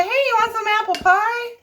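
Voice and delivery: high, sweet, innocent-sounding offer; mock innocent offer. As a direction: play it sugary high, hiding the zucchini. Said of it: "I'll just say".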